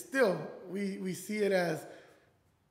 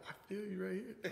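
very soft, teasing aside; mock sympathy; 13 dB quieter than usual. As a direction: murmur fake sympathy while walking by. Teasing sympathy murmured while passing.

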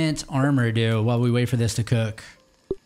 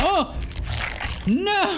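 surprised shout. Real, not acted. Sudden danger in the fight.

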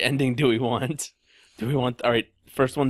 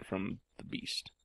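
whispering; 3 semitones lower, 13 dB quieter, slower than usual. Acted. Spooky hushed tone.

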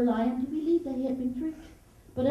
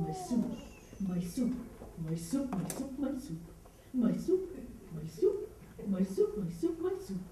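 low, softer rhythmic repeated chant; swimming lion; 4 semitones lower, 6 dB quieter than usual. Acted. Lion swimming along after his meal, soft low chant. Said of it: "Lion was swimming and saying".

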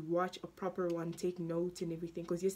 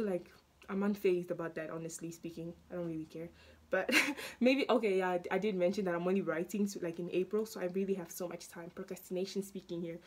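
ordinary delivery

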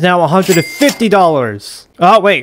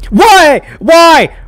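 alarmed cry; 8 semitones higher, 5 dB louder than usual. Real, not acted. Chased by Seek.

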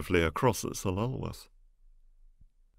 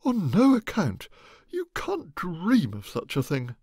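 high, loud protest; alarmed exclamation; 11 semitones higher, 5 dB louder than usual. Acted. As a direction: cry out in alarm. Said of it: "exclaimed Amanda".

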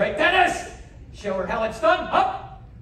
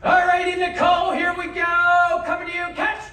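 calling out; 8 semitones higher, 4 dB louder than usual. Real, not acted. Calling out a throw.

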